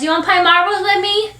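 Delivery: put-on very high tiny-child voice, rising; little boy. Little boy asking neighbour for a game, eager. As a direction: beg a grown-up to play.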